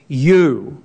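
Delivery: a firm, drawn-out YOU; strong emphatic YOU. Shown as a demonstration. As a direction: land hard on the single word YOU. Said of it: "it's quite strong".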